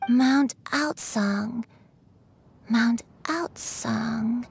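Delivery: slow, halting repetition of a name; trance-like, drawn-out syllables; dazed recollection. Acted. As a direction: murmur it slowly, searching your memory. Elder struggles to place name.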